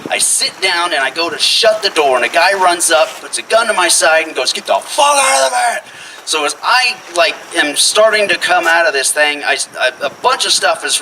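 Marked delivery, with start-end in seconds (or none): 4.5-5.8 high, urgent mock yell; imitated high-pitched hold-up order; gunman's demand. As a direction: act out an armed robber's command. Gunman orders him out, retold.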